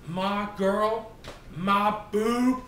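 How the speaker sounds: normal talking voice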